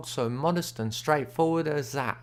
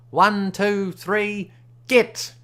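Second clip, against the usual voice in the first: a high, loud countdown rising to a shouted "git"; starting call; 8 semitones higher, 7 dB louder, much slower than usual. A narrator's reading. Smiley starts the frog race with a loud countdown. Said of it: "Then he says".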